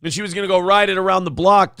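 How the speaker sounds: ordinary delivery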